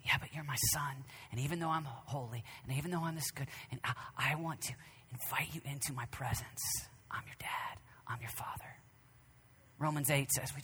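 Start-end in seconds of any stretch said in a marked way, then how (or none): 0.1-8.8 soft, whispered fatherly reassurance; hushed, gentle father's voice; tender whisper. Acted. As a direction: whisper it like a loving dad. God voiced tenderly to child.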